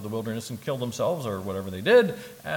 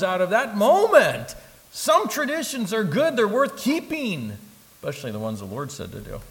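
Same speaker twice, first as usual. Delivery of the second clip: preaching at full force; 9 semitones higher, 5 dB louder than usual. Acted. Preacher rousing the congregation about communion, at full force. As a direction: hammer it home from the pulpit.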